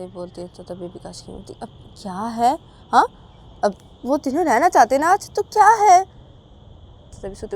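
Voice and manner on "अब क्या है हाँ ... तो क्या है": loud, high pleading retort; raised voice. Acted. Mother loudly defending the three staying together. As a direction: push back loudly.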